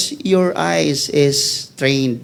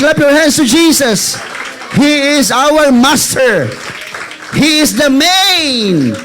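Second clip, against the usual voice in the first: loud, fervent preaching, slowing on key words; loud preacher pressing the point home; full-force preaching; 7 semitones higher, 8 dB louder, slower than usual. Acted. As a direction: preach it with rising fire. Preacher building to fervent peak.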